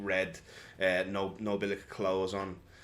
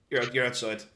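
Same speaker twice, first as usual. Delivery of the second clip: ordinary delivery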